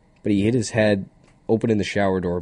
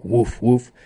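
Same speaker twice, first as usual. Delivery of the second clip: louder playful barking; mock dog bark; 4 semitones higher, 5 dB louder than usual. Calling himself the junkyard dog, playful bark. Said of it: "I'm the junkyard dog".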